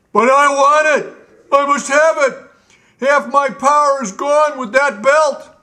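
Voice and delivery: high, loud, greedy royal whine; Gnome King's tantrum. Acted. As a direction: stamp and demand it like a spoilt tyrant king. Gnome King demands his belt.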